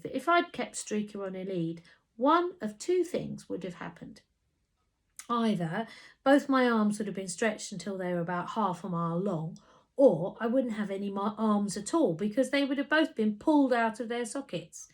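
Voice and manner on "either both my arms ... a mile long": plain reading voice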